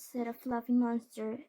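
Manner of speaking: usual speaking voice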